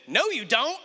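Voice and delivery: put-on high, bratty child voice; mock a toddler sister teasing; sing-song childish denial; teasing little girl. Sister teasing in retold story.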